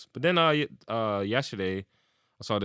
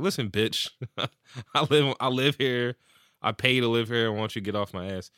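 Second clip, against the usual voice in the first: louder, higher telling-off; imitating a fed-up tenant snapping back; mock fed-up retort; 4 semitones higher, 4 dB louder than usual. Playing the man told to show ID, a louder fed-up retort. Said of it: "'Cause I'm like".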